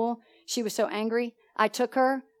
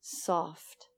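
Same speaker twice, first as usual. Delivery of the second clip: low, hushed single word; soft; 5 semitones lower, 10 dB quieter than usual. Shown as a demonstration. Preacher teaching how to meet anger, soft. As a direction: let the word fall softly. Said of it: "you just be what?".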